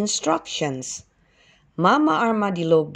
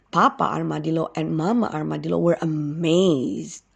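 ordinary delivery, nothing marked

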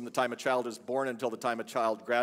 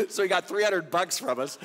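higher, louder, talking through real laughter; real laugh breaking into the words; amused laughing; 6 semitones higher, 4 dB louder than usual. Real, not acted. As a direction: laugh your way through the punchline. Laughing at own story's punchline.

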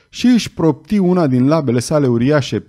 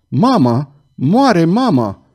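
anguished cry; 4 semitones higher, much slower than usual. Acted. Girl cries that mother dies.

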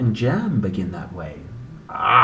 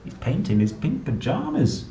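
usual speaking voice

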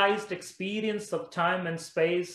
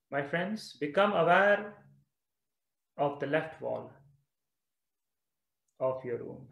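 guided meditation; 3 semitones lower than usual. Real, not acted. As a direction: lead them gently through it, leaving long pauses.

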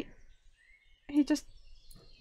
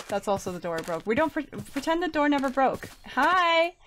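ordinary talk

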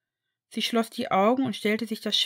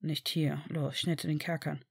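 hushed urgency; 5 semitones lower, 7 dB quieter than usual. A narrator's reading. Blaise hushing urgently.

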